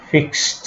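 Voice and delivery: "ফিক্সড" said as "fikst"; final -ed as T. Shown as a demonstration. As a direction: close "ফিক্সড" on a voiceless t. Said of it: "ট এর মতো উচ্চারণ হবে". ("ফিক্সড"-এর শেষে ট উচ্চারণ)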